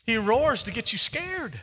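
loud, high, driving sermon voice; full-force preaching. Acted. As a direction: preach it hard to the crowd.

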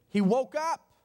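loud, high burst with rising end; sudden exclamation. Real, not acted. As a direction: punch it out to jolt the congregation. Preacher's sudden climax after slow phrase.

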